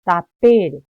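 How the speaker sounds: Tapere with a very loud "pe" in the middle; loaded stress. Shown as a demonstration. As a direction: punch the "pe" of Tapere. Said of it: "ahí le estoy cargando yo".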